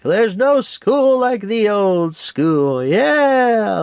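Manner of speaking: put-on two old men watching the fight, cheering loudly; old dudes cheering. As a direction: stretch it out like a cheering old-timer. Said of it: "and then they're like".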